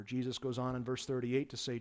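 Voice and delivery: ordinary talk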